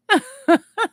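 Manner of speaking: squealing laugh; high burst of laughter. Real, not acted. Streamer laughing at chat, high squeal.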